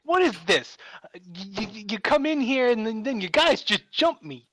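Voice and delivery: angry crayfish. Acted. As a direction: play an affronted Brooklyn Italian crayfish. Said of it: "motioning with its claws in an angry fashion".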